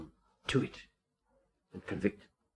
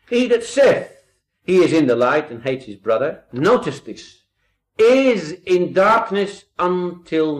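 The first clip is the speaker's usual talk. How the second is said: louder, raised preacher's voice; fervent preaching, louder and raised; 5 semitones higher, 8 dB louder than usual. Acted. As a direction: preach it out to the congregation. Preaching at full force.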